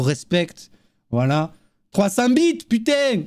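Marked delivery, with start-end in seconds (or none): 1.9-3.2 excited. Real, not acted. Excited shout at a big donation.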